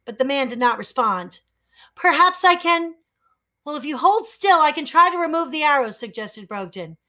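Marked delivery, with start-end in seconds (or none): 1.9-6.0 put-on higher boy voice, tentative then fast; hesitant boy. Boy offering to pull the arrows. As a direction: offer to help nervously.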